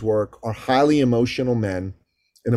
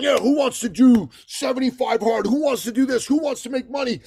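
a hint of a pitched-up social media hype man; mild mocking influencer call-out; 10 semitones higher than usual. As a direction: lightly imitate the online pitch. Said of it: "they are just going".

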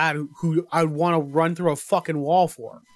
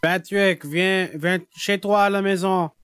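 put-on co-host's Quebec French accent. Co-host performs on request for the host, Quebec accent. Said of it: "I can't do a Quebec accent. [name], say".

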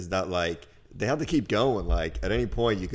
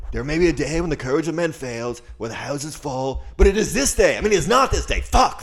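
higher and louder, building to a bungled shout; Aragorn speech parody; 7 semitones higher, 6 dB louder than usual. Retold or imitated. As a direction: give a rousing battle speech and botch it. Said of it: "recreate that scene from Lord of the Rings".

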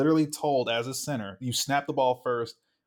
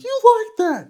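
very high, loud mock victory yell; over-the-top high-pitched celebratory shout; Kirk Cousins; 11 dB louder, slower than usual. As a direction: imitate a hyped-up quarterback screaming in triumph. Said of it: "I thought about, like, Kirk Cousins".

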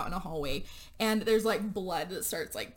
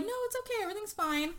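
very high, falsely reassuring voice; squeaky over-sweet reassurance; mock soothing friends; 12 semitones higher, 4 dB quieter, faster than usual. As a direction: coax her to stay, faking calm. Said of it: "they're like".

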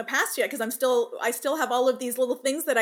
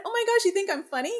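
high, delighted mock disbelief; surprised delight; 10 semitones higher, faster than usual. Mom delighted her kids find her funny, high surprised. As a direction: gasp it out, thrilled and surprised. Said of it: "And I said".

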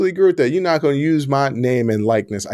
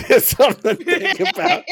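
higher, laughing repeat; laughing echo. Real, not acted. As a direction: echo it while cracking up.

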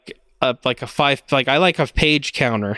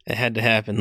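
ordinary talk

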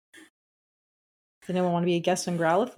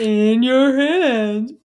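quoting SpongeBob; 4 semitones higher, 6 dB louder, much slower than usual. Retold or imitated. Quoting SpongeBob jellyfishing line.

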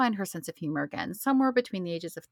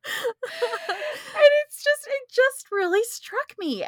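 laughing; 4 dB louder, much slower than usual. Real, not acted. Moved, after laughing fit.

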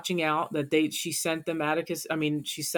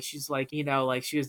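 usual speaking voice

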